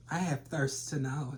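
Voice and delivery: usual speaking voice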